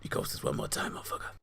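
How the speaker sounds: hushed menacing whisper; whispered movie quote. Retold or imitated. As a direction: breathe it out like a threat. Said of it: "When he goes".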